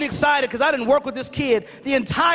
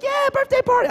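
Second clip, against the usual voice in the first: put-on high-pitched, eager child's cheer; imitating an excited niece; excited little girl; 13 semitones higher than usual. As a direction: squeal it like a thrilled preschooler. Excited four-year-old niece answers.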